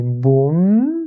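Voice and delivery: "boum" slowly, with a long hummed final m; closed held m. Shown as a demonstration. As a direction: hold the lips shut on the m of "boum". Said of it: "un mim avec soukoun".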